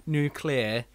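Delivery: nuclear as NOO-klee-uh; nuclear set apart, "new-clear"; corrected pronunciation. Shown as a demonstration. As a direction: say nuclear carefully, with "klee" in the middle. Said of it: "I always say that wrong".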